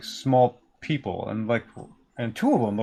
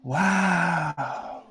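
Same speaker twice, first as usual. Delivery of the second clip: put-on higher, drawn-out childlike wonder; awestruck kid; 6 semitones higher, much slower than usual. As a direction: speak slowly, as if meeting your heroes. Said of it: "these two kids look up at you".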